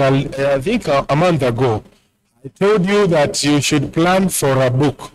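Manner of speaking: usual speaking voice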